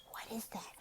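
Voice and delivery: put-on hushed whisper to the child; soft whispered prompt to a young learner. Shown as a demonstration. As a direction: whisper as if sharing a secret. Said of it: "demo to class".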